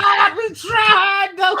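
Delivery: high, loud, drawn-out wail; wailing shout. Real, not acted. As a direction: holler it out long and high. Mock lament amid joking banter.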